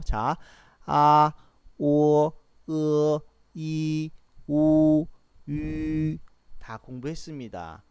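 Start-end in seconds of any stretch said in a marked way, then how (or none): 0.9-6.2 아, 오, 으, 이, 우, 유 each held long on a level pitch; vowel demonstration. Shown as a demonstration. Teacher reviews six simple vowels, each sustained and level. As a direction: sound out 아, 오, 으, 이, 우, 유 as separate sustained vowels. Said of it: "단음모 여섯 가지 발음 아시죠?".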